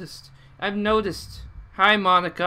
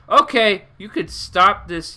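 alarmed; 5 semitones higher, 9 dB louder, slower than usual. Real, not acted. Player alarmed, telling Yuri stop.

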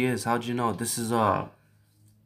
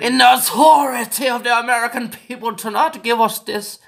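higher, louder grandiose speech; hammed-up formal indignation; mock pompous orator; 6 semitones higher, 3 dB louder than usual. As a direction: deliver it like an outraged senator.

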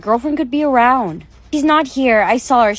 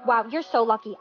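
ordinary talk, nothing marked

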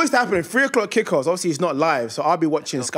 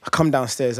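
ordinary delivery

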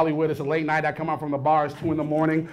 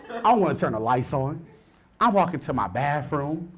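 normal talking voice

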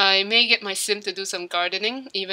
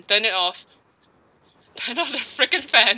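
laughing demand; 3 semitones higher, 5 dB louder than usual. Real, not acted. Demanding friend turn off fan.

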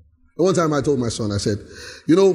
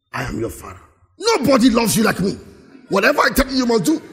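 mock bossy father; 4 semitones higher, faster than usual. Mocking authoritarian father.

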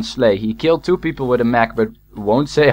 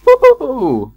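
voice crack; 11 semitones higher than usual. Real, not acted. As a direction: cheer that cracks up high. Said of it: "that was a voice crack".

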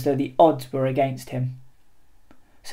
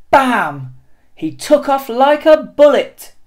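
bursting out louder and higher as he launches; excited narration; 9 semitones higher, 9 dB louder than usual. A narrator's reading. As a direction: read it like a rocket taking off. Penguin blasts off into flight.

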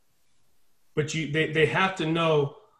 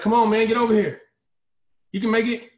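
higher, louder and fast, calling him across; encouraging call; 6 semitones higher, 5 dB louder, faster than usual. Retold or imitated. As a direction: shout encouragement from the far end.

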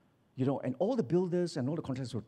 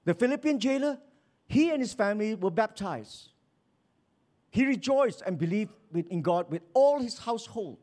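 loud, raised declamatory reading; preaching scripture; 8 semitones higher, 4 dB louder than usual. Acted. Preacher citing scripture on saved families, declaiming. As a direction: proclaim each phrase to the congregation.

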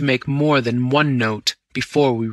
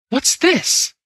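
much higher, startled question; shocked gasp; 13 semitones higher than usual. A narrator's reading. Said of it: "I gasped".

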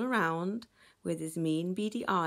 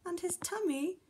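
high-pitched anxious cry; high, worried alarm without shouting; flustered fishes; 11 semitones higher than usual. Acted. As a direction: fret it in a high voice. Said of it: "All of the fishes were flustered".

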